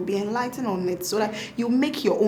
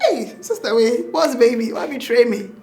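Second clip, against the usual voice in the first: mock outrage; 3 semitones higher, 6 dB louder than usual. Mother to newborn who looks like the father, laughing mock outrage. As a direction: tease with a laugh in it. Retells teasing newborn for betrayal.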